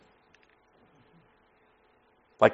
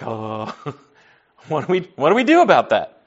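stunned reaction; 2 semitones higher than usual. Retold or imitated. Crowd hears they killed the Messiah, retold stunned reaction. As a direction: mimic listeners taken aback, restarting the question.

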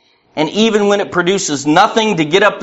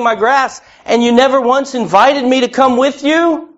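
higher, accusing, wounded questions; pleading neighbor; 8 semitones higher than usual. Acted. Neighbor at the throne turning on you, higher and hurt. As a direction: rebuke your friend for never telling you.

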